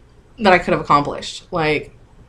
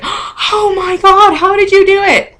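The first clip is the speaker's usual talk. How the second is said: much higher, slow gasping "oh my God"; hammed-up waitress gasping in disbelief; imitated astonished waitress; 13 semitones higher, 4 dB louder, slower than usual. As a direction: play the stunned waitress, squeaky with amazement.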